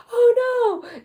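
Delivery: high, loud worried wail; high-pitched pretend dismay; mock panic. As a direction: imitate a stressed-out worrier overreacting. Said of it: "the kind of stress you would have".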